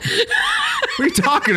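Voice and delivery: high squeal through laughter; slow, laughing high whine. Real, not acted.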